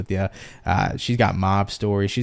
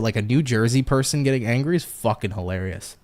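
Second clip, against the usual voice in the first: ordinary delivery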